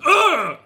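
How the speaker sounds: loud, high effort grunt; tennis grunt. Acted. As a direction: grunt like hitting a tennis serve. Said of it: "everybody makes a tennis grunt".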